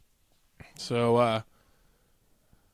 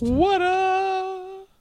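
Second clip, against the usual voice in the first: high, long-held singsong whoop; hype call; 13 semitones higher than usual. Pretend. Co-host hyping each beer listed, high singsong. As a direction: hold the last note up high.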